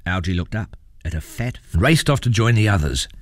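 ordinary delivery, nothing marked

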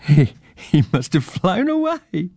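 high, slow words through a giggle; laughing. A narrator's reading. Girl jokes about the vanished old man, laughing. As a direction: laugh your way into the line as a child. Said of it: "laughed Joe".

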